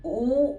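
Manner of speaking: ഊ as a long rounded "oo"; German letter U. Shown as a demonstration. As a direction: say ഊ slowly, like German "U". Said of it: "യുവിനെ നമ്മൾ pronounce ചെയ്യുന്നത്".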